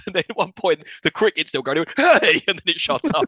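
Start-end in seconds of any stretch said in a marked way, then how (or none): 2.0-2.4 high, loud mock yell; retold shout. Disturbed sleeper at a cricket still going, loud imitated shout. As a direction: imitate a sharp annoyed shout. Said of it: "comes out and shouts out".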